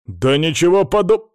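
loud, high objection; indignant, interrupted outburst; exclamation cut short. A narrator's reading. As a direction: blurt it out and break off mid-word. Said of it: "хотел было воскликнуть Колян".

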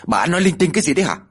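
high, fast irritated retort. A narrator's reading. Husband dismisses his wife's fears, irritated. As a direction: cut her off, annoyed. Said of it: "Ông Mai bấy giờ mới cáu".

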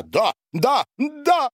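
rising excited cries, ending very high; sudden gleeful yell. A narrator's reading. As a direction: burst out yelling with glee. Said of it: "завопил вдруг Борис и расхохотался". (восторженный вопль с подъёмом)

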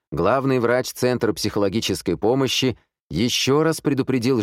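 high, projected newsreader delivery; radio news read. A narrator's reading. Radio announcer addresses the worried city, news-bulletin style.

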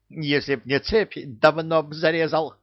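parrot voice. A narrator's reading. Parrot threatens.

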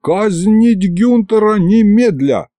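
high, slow, ringing proclamation; drawn-out, high-pitched mock command; proclaimed order. A narrator's reading. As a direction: proclaim it like a royal decree. Mock royal order of execution.

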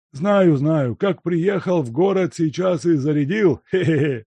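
put-on high, loud old servant snickering; sly teasing chuckle. A narrator's reading. Servant mocking the guest's drinking with a snicker. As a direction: needle him and giggle.

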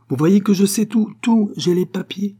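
high, loud, insistent yelp; excitable woman yapping her threat; shrill yelping. A narrator's reading. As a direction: yelp it triumphantly, pitched right up. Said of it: "glapit madame Gordeloup".

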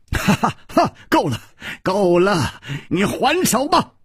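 high-pitched laughing taunt; laugh, then call out high and breezily. A narrator's reading. Chu Liuxiang invites Shuimu to strike back, laughing. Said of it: "楚留香笑着说".

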